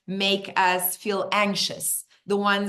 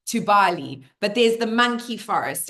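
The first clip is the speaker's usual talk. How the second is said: ordinary talk, nothing marked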